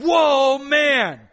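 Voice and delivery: loud, high, hammed-up amazed whoa; amazed exclamation. Adam seeing the woman for the first time, a loud hammed-up whoa. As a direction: play Adam, bowled over at first sight. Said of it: "when Adam saw her, he said".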